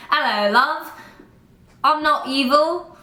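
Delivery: playful fake British voice; British accent. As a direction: put on a mock British charmer. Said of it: "'cause he has a British accent".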